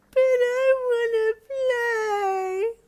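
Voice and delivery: slow, loud, high crying wail; wailing child. Acted. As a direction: wail like a toddler in tears. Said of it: "wailed Kyo suddenly".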